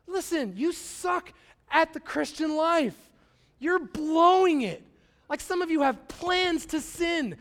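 high, slow emphatic preaching; impassioned preaching. Real, not acted. Pastor telling the congregation God delights in them, impassioned. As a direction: hammer each phrase, drawing out the key words.

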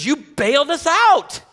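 slow, high, loud accusing voice; Scott's voice. Retold or imitated. Pastor imitates Scott accusing him of rescuing the room, high and loud. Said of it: "He goes".

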